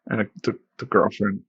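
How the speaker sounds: ordinary talk, nothing marked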